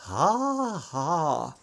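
two soft, strained ha's; forced laugh. A narrator's reading. Peter reacting to Justus's remark, forced laugh. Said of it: "Peter lachte gepresst".

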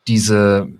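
usual speaking voice

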